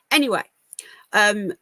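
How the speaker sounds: normal talking voice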